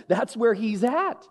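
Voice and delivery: ordinary delivery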